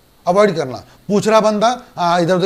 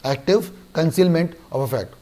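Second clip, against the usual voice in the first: slow dictation; much slower than usual. Shown as a demonstration. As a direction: let "active concealment of a fact" sink in slowly. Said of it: "दो word पे ध्यान दें". ("active concealment of a fact" धीरे और धीमी आवाज़ में)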